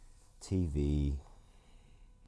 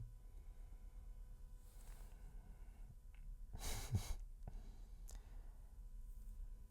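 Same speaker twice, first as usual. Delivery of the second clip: soft unvoiced chuckle; quiet breathy giggle; silent laugh; 8 semitones higher, 12 dB quieter than usual. Real, not acted. Laughing at own theory.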